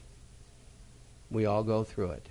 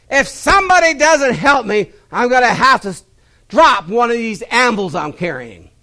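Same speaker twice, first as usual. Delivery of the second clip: higher, louder desperate punchline voice; drowning man; 11 semitones higher, 14 dB louder than usual. Acted. Drowning man pleading at the punchline, loud plea. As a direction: deliver the man's plea as a joke. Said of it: "Came back up and said".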